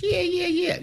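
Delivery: "Yeah, yeah, yeah" in a sudden very high squeak; high pitch demo. Shown as a demonstration. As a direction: jump way up in pitch to show a voice change. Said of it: "meaning high to low pitch".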